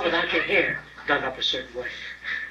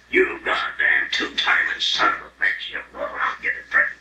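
jealous husband rage; 14 semitones lower, 5 dB louder than usual. Acted. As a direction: growl it low like a betrayed husband. Jealous husband berating cheating wife.

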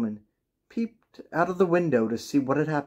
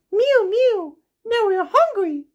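very high, squeaky kitten chorus voice; mewing cats; 5 dB louder than usual. A narrator's reading. Millions of cats at the pond, begging in a tiny high mew. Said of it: "said the hundreds of cats".

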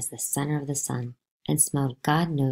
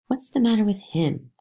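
higher, puzzled question; bewildered; 7 semitones higher than usual. Acted. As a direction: ask as if baffled by the boy. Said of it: "Granny, bewildered".

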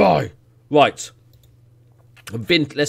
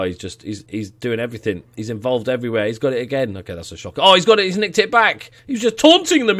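normal talking voice

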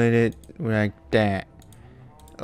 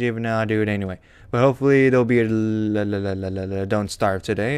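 ordinary delivery

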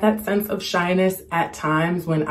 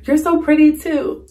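higher, sweet lightly voiced compliment; imitating Kristen Bell; 10 semitones higher, 5 dB louder than usual. A hint of Kristen Bell answering a young fan's compliment. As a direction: hand the compliment back brightly. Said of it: "And she was like".

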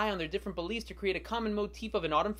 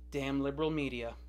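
sarcastic grumble; 6 semitones lower, 3 dB quieter than usual. Acted.

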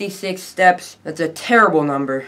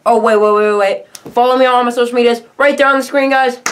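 much higher, louder, fast; sudden excitement; 8 semitones higher, 5 dB louder than usual. Real, not acted. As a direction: burst back in, all hyped. Sudden remembered outro call.

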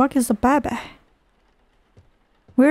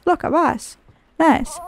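normal talking voice

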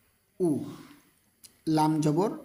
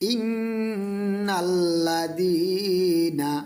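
long melodic chanted word; drawn-out tilawat; slow Quran recitation; 3 semitones higher, much slower than usual. Shown as a demonstration. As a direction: recite it slowly with tajweed. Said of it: "একটা একটা করে অক্ষর উচ্চারণ".

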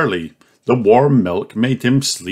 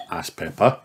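usual speaking voice

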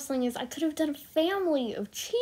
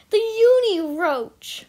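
slower, much higher and louder announcement; a friend hyping the drawing's name; big reveal; 9 semitones higher, 9 dB louder, slower than usual. Acted. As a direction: unveil it like a showman. Grand reveal of hybrid.

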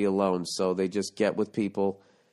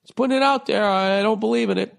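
much higher, louder innocent mock voice; mock girlfriend voice; 10 semitones higher, 3 dB louder than usual. Host mocking the caller's girlfriend in a high, innocent voice. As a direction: play the liar all sweet innocence, pitched way up. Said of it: "Why did you just bring that up".

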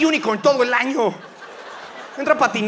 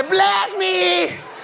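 excited fan; 10 semitones higher than usual. Pretend. Crowd begging a blessing.

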